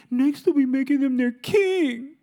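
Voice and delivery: whiny Saul. Retold or imitated. Preacher voicing jealous king Saul, whiny. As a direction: whine it like a sulking king. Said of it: "he's just whiny".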